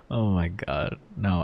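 ordinary delivery

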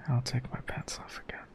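fast, shy little whisper. Real, not acted. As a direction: mumble it, half embarrassed. Resuming, quiet and shy.

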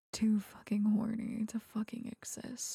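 ordinary talk

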